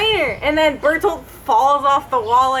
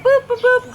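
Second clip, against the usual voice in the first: high, bouncy boops, a bit louder; silly sound effect; 10 semitones higher, 4 dB louder than usual. Retold or imitated. Friend mocking dumb Titan fight, high silly boops. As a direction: toss off three cartoon boops, pitched way up.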